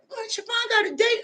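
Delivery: high, teasing onlooker voice; playful high gossip voice; mock gossiping onlooker. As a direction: squeal it like a nosy neighbor spotting you out. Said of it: "Y'all gonna see me out there".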